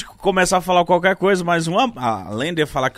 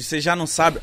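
ordinary talk

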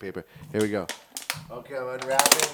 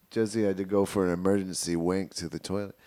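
usual speaking voice

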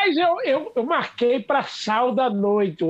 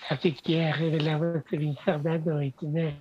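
soft, lower sweet-voiced take on Viviane; Viviane's sweet voice; 6 semitones lower, 6 dB quieter than usual. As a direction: coo it gently like a sweet-voiced woman. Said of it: "Faz a voz dela".

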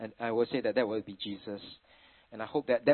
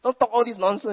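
higher, louder wifely scold; mock scolding wife; 8 semitones higher, 5 dB louder than usual. Wife telling the joking family to stop, mildly scolding. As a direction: tell the jokers off like a wife. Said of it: "my wife will say".

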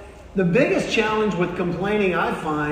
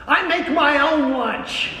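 high, drawn-out comic yell; exasperated outburst; 9 semitones higher, 4 dB louder, slower than usual. Acted. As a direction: wail the punch line in mock fury. Punch line, worker's outburst.

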